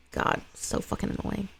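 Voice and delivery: breathless unvoiced grumble; whispered aside. Real, not acted. Reader grumbling at the script's lines, whispered. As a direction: whisper it in disgust.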